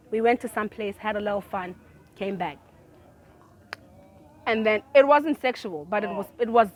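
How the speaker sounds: usual speaking voice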